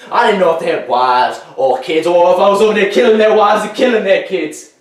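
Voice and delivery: building to a high, anguished shout; anguished outburst. Acted. Veteran confessing he killed people, rising to anguished shouting. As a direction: let guilt climb until you are shouting.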